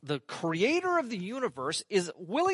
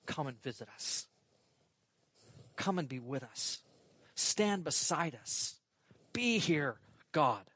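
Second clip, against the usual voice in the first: soft prayer; 6 semitones lower, 6 dB quieter than usual. Retold or imitated. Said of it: "constantly praying".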